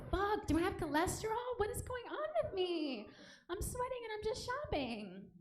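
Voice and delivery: high, anxious self-talk; panicked inner voice. Retold or imitated. Inner voice, sweating in mall.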